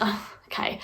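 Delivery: breathy resigned whisper. Retold or imitated. Pupil whispers, resigned, on hearing she is on timeout. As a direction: whisper it, deflated.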